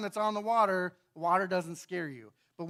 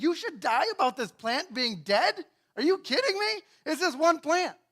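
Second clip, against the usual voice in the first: mock disbelief; 8 semitones higher, 6 dB louder than usual. God incredulous at Jonah.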